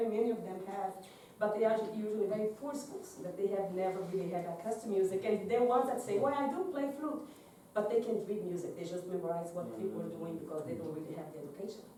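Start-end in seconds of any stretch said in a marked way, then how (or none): none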